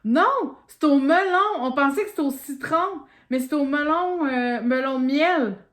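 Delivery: loud, high surprised outburst; shocked exclamation, shouted. Real, not acted. Surprise at the melon flavour.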